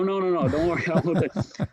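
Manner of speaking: laughing reassurance; chuckling don't worry. Real, not acted.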